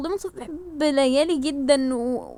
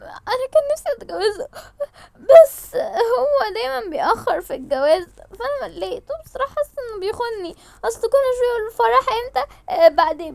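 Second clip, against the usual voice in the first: high, teary, breaking voice; half-crying confession; tearful complaint; 6 semitones higher than usual. Acted. Layali explains through tears. (شكوى بدموع وصوت مكسور)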